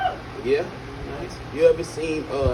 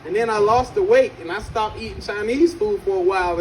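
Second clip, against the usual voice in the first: ordinary talk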